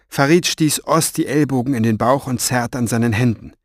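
ordinary delivery

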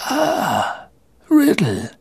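high, feeble greeting; thin old wizard recognising a pupil; frail old headmaster. Acted. (gebrechlicher alter Schulleiter)

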